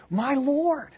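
high-pitched startled cry, acted out; surprised exclamation. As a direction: exclaim as if suddenly amazed, put on. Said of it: "he's so surprised that he says".

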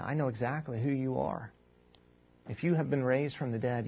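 soft, hushed confession of faith, acted; solemn recognition. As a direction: address God softly and earnestly. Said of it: "What Thomas is doing here is he's saying".

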